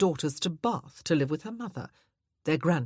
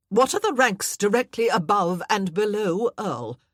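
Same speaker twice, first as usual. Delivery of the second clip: louder, pressing interrogation; fussy aunt quizzing; 4 semitones higher, 8 dB louder than usual. Acted. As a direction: fire the questions at her. Aunt Matilda quizzes Anna.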